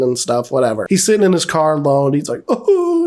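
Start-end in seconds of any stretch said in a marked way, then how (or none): 2.5-3.1 high, drawn-out imitation moan; mock sad wail.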